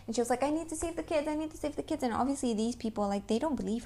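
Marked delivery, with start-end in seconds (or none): 0.4-2.0 high-pitched imitation of a fixated heroine; mock desperate insistence. Host mimicking the film's heroine, high mock desperation.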